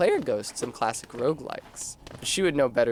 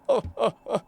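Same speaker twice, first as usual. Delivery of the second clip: sly character chuckle; 8 semitones higher than usual. Acted. Character laugh mid speech.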